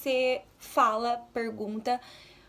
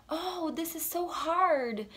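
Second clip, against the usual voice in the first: slow, lightly acted complaint; lightly voiced American; 5 semitones higher, much slower than usual. Shown as a demonstration. Teacher imitating a student, slow mock complaint. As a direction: sigh it out like a frustrated student. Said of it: "eles vão falar assim".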